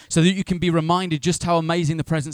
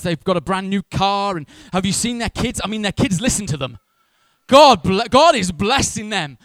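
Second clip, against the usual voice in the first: loud, hammed-up delight for others; gushing praise; 3 semitones higher, 5 dB louder than usual. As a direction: rave about friends' blessings, building to emphatic high peaks. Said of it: "Rarely do we speak like that".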